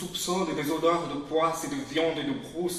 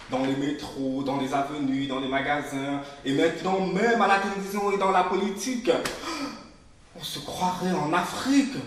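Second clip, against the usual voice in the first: rising indignation; 6 dB louder than usual. Acted. Speaker complaining about Paris, louder and indignant. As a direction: spit out the list with growing contempt. Angry list of where they are.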